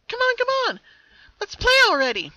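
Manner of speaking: very high, loud, eager sing-song call; a child's voice begging to play from afar; chipper call. A narrator's reading. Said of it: "the chipper voice echoed".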